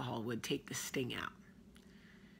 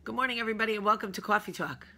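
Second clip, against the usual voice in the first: host intro; 5 semitones higher, 5 dB louder, much faster than usual. Acted. Show opening.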